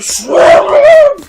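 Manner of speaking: very high, loud outraged screech; screaming little brother. Retold or imitated. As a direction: screech it like a boy mid-scuffle. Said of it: "And he's like".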